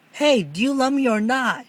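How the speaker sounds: sharp high demanding question. Acted. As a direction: press him for an answer. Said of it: "demanded Cindy".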